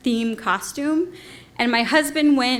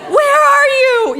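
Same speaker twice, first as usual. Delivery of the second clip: mock yell; 13 semitones higher, 7 dB louder, slower than usual. Callback to ex's street yell.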